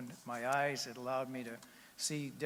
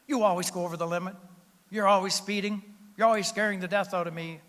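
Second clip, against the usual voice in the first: nagging wife, loud and higher; scolding wife; 6 semitones higher, 10 dB louder than usual. Retold or imitated. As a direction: scold him loudly for speeding. Wife scolds husband in joke.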